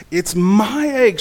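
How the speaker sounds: loud, high, slow protest; indignant sputter. A narrator's reading. Bird demands her egg back, sputtering indignantly. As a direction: punch each word high and loud in outrage. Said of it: "she sputtered".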